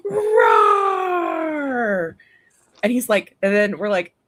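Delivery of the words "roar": high, loud playful roar; mock dinosaur roar. Aunt imitating her nephew's dinosaur roar.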